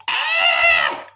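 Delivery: very high, loud comic shriek; mock high-pitched scream of fright; Kevin Hart scream. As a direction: shriek like a panicked comedian. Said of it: "that sounded like Kevin Hart, that scream".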